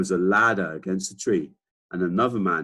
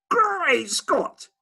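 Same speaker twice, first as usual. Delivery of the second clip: much higher, astonished exclamation; gasping Duke; 4 dB louder than usual. Acted. Duke staggered by Billy offering cherries, much higher gasp. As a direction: splutter in total shock. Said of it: "he gasped".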